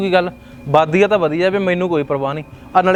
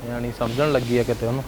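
low grumble; 9 semitones lower, 7 dB quieter than usual. Acted. Son grumbles to himself after scolding mother, lower and softer.

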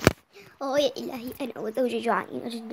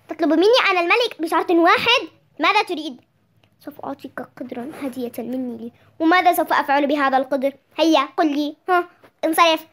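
loud indignant royal voice, higher; king scolding the poor man, loud and angry; 3 semitones higher, 7 dB louder than usual. Acted. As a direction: put the impertinent man in his place. King offended by a condition.

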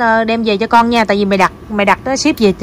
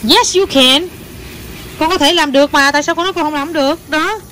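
encouraging; 6 semitones higher than usual. Real, not acted. Encourages child who doubts.

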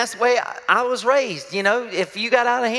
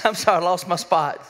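usual speaking voice

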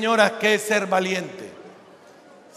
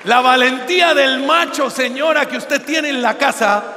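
normal talking voice

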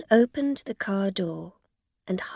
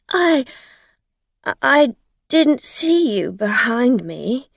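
flustered; 5 semitones higher, 9 dB louder than usual. A narrator's reading. Driver stammering defensively.

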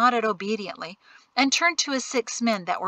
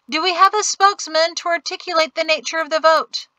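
loud; 8 semitones higher, 4 dB louder than usual. Acted. Colonel addressing the crowd.

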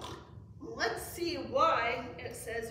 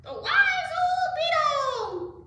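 high, loud, drawn-out amazement; awestruck exclamation; 9 dB louder, slower than usual. A narrator's reading. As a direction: exclaim it in shocked awe. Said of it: "gasped the animals".

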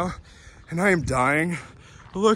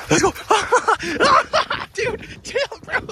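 excited high yelp. Real, not acted. Dog running off.

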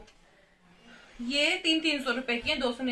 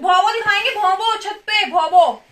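yelling across the room; 7 semitones higher, 9 dB louder than usual. Real, not acted. Calling while soothing the baby.

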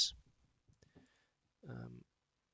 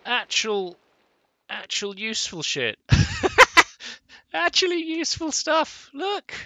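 high, loud gasping delight; thrilled; 13 semitones higher, 16 dB louder than usual. Real, not acted. Player thrilled by loot in a chest, high loud squeal.

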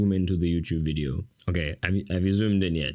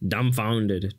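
usual speaking voice

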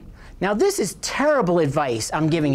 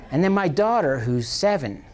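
ordinary talk, nothing marked

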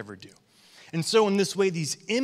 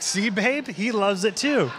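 higher-pitched, gleeful "told you so"; playful retold vindication; 6 semitones higher, 3 dB louder, slower than usual. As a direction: lightly crow to your wife that you were right. Acts out gleeful vindication to wife.

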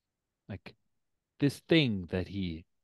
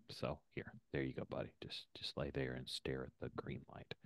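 soothing pet; 7 semitones lower, 16 dB quieter, faster than usual. Real, not acted.

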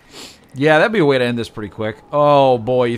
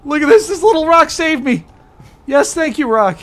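excited; 13 semitones higher, 6 dB louder than usual. Real, not acted. Amazed rock saved him.

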